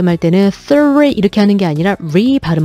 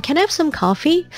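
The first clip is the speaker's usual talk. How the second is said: higher, slow friendly request; casual asking; 7 semitones higher, much slower than usual. Shown as a demonstration. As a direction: ask a friend for coffee, lightly. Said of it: "약간 이런 느낌".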